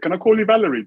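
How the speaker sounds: lightly acted lady, lilting high; imitated cleaning lady. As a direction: pitch it up as the workmate's request. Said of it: "the lady says to my mother".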